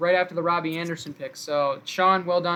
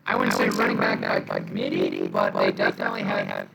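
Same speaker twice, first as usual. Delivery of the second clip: glitchy robotic connection voice. Real, not acted. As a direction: sounds like a robot over a bad line. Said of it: "You sound straight like a robot right now".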